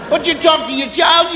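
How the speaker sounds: ordinary talk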